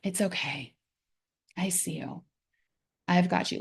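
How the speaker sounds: very soft, hushed and tender; calm a frightened pet at the vet; soft soothing tone. Shown as a demonstration. Talking to a scared pet at the vet, very soft and soothing. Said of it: "a very soft, soothing tone".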